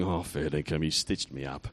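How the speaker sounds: very low, quiet grumble; [name]. Acted. Voicing a volunteer who was stitched up, low muttered grumble. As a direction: mutter it low, as someone who's been set up. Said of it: "[name]'s going".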